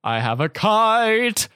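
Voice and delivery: high, slow, grand announcement; mock fatherly excitement. Father showing his kids the kite, hammily excited. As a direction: present it like a great surprise.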